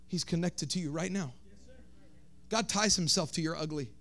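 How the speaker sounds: very soft, low and tender; almost whisper the comfort; soft ministry voice. Real, not acted. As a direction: pastor quietly comforting the congregation. Soft low ministry after climax.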